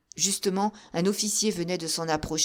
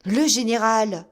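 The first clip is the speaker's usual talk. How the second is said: louder, dismayed repeat; echoed alarm; 3 semitones higher, 7 dB louder than usual. A narrator's reading. Soldiers repeating the alarm, louder. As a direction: echo in dismay. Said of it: "répétèrent les soldats".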